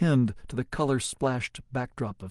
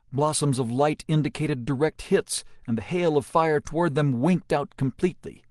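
ordinary delivery, nothing marked

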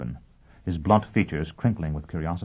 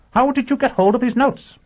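very high, loud repeated question; insistent demand; 8 dB louder than usual. Acted. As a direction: demand an answer, voice shooting up. Repeats his unanswered question.

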